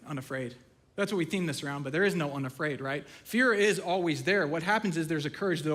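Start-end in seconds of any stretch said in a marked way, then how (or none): 0.1-0.6 quiet doubting echo. Shown as a demonstration. Preacher quietly questions his own theme word Unafraid. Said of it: "and that's not even true, is it?".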